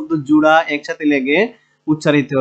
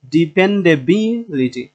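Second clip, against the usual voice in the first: ডিপেন্ডেবিলিটি slowly, বি held and lifted; teacher's careful ডিপেন্ডেবিলিটি, weight on বি; stress on বি; slower than usual. Shown as a demonstration. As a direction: stretch বি in ডিপেন্ডেবিলিটি and let litti fall. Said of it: "এই বি এ এসে জোর দেব".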